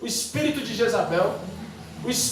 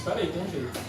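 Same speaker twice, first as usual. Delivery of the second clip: low, hushed scheming aside; sly Balaam; 6 semitones lower, 11 dB quieter, faster than usual. Acted. Balaam calming an indignant king, low and hushed. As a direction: lower and soften, holding them back. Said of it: "Balaão disse".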